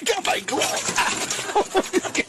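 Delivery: ordinary talk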